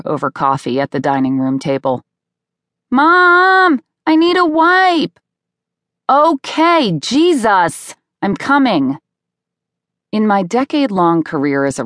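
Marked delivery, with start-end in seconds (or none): 2.9-5.2 high, loud whining holler for mom; child's insistent yell. A narrator's reading. Boy calls again, louder. 6.1-9.0 raised, high-pitched irritated call back; exasperated mom. A narrator's reading. Exasperated mom answers second call.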